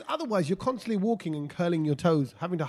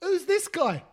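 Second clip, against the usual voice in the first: put-on child's voice; 11 semitones higher, much slower than usual. Imitating the kid's line.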